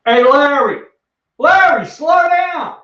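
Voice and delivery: loud, slow shout across the room; calling out. Pretend. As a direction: call out to Larry across the sorting floor. Postal worker calling to coworker.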